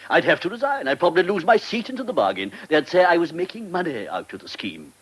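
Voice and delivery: normal talking voice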